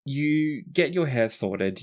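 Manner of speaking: ordinary talk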